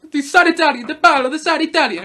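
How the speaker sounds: high, loud bad Italian accent; Jared Leto. Reviewer mocking an actor's Paolo, loud mock-Italian accent. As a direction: ham up a fake Italian accent. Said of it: "I'm not doing a fantastic Italian accent".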